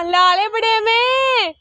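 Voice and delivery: loud, slow call across the fields. Acted. Neighbour calls out across the field in a loud, slow call. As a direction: stretch it out, calling to someone far away.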